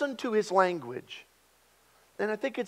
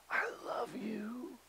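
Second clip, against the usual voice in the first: tender whisper; 6 semitones higher, 12 dB quieter, slower than usual. Shown as a demonstration. Pastor showing how an audiobook narrator says it, whispered tenderly. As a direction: breathe it slowly, soft and unvoiced.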